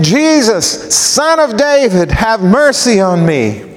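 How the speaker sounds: high cry that falls away at the end; calling out. Acted. Blind beggar calling out to Jesus over the passing crowd. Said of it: "He called out".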